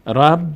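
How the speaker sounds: رب as one held "rab", ending on b; closed syllable. Shown as a demonstration. Teacher showing the first reading, closed syllable. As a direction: join r onto a vowelless b in رب. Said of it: "ر رو میچسبانیم به ب".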